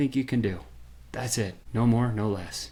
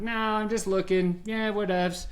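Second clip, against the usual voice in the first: higher, bored mock brush-off; dismissive brush-off; 8 semitones higher than usual. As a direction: shrug off the salesman lazily.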